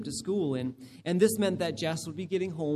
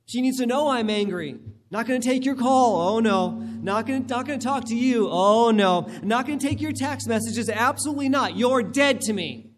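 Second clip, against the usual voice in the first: louder, higher, spiteful refusal to talk; hammed-up husband vowing silence, loud and cutting; mock cold shoulder; 3 semitones higher, 5 dB louder than usual. Husband shutting out his wife, mock angry and loud.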